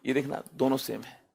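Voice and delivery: ordinary talk, nothing marked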